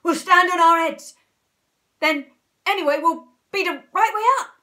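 loud, high eager announcement; triumphant storybook shouting; excited cry. A narrator's reading. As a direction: proclaim the plan at full volume. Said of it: "cried Mister Twit".

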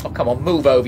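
ordinary talk

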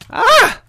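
piercing high shriek; loud scream. Real, not acted. Player screaming mid-fight at the attacker. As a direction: shriek in real panic.

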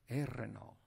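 Arenal softly with a Spanish rolled R. Shown as a demonstration. Preacher softly corrects himself to the local Arenal. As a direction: drop to a soft aside and roll the R in Arenal. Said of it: "they roll the R".

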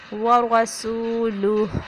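وَرَسُولُهُ slow, stopping on ha; correct recitation. Shown as a demonstration. Teacher demonstrating waqf. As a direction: pause on the ha of وَرَسُولُهُ. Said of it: "وقف کریں گے تو ہا کی sound آئے گی".

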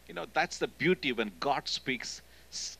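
ordinary talk

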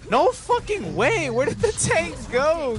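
high, slow disbelieving cry; hyped gamer bro. Real, not acted. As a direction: stretch it out in disbelief. Losing the tank in fight.

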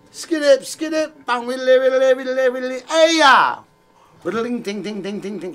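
high scatted guitar noises; vocal guitar riff. Shown as a demonstration. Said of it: "Should I just do that for the rest of the show".

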